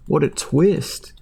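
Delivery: mock amazement, drawn out; sarcastic. Real, not acted. Mocking the movie's twist, slow and sarcastic. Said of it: "That's crazy".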